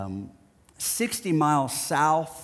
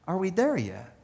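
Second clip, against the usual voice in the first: softer, earnest prayerful question; sincere prayer; 3 semitones higher, 6 dB quieter than usual. Retold or imitated. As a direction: ask quietly and honestly in prayer. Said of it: "I know, Lord".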